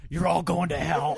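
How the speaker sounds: high, loud joke condemnation; mock damning shout. Joking about the teacher's swearing, mock damning shout. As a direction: bellow it in mock fury.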